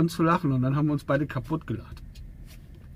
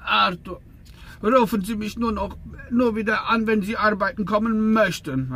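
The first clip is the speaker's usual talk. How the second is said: imitating the boss; 8 semitones higher, 3 dB louder than usual. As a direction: boss voice, higher and a little louder. Boss quote on the phone. (nachgemachter Chef, höher und etwas lauter)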